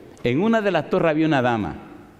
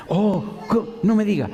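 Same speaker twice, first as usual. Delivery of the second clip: higher, pleasantly surprised; suddenly sweet and impressed; changed tone; 8 semitones higher than usual. Retold or imitated. The professor learning the pastor himself called, surprised. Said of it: "ya cambió el tono".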